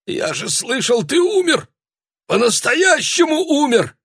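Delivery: высокий панический голос; кричит в ужасе; terrified cry. A narrator's reading. Дядя в ужасе от призрака. (панический испуганный крик)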